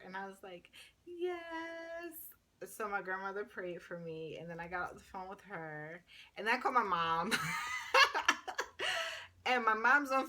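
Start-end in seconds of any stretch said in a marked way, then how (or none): 1.1-2.2 small meek yes. Retold or imitated. Answering grandma's offer to pray. 7.4-9.4 high laugh. Real, not acted. Laughs telling about calling mom.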